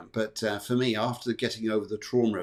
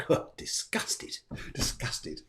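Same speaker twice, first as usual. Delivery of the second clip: disgusted Scorpio; 7 dB quieter, slower than usual. Pretend. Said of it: "Scorpio is like".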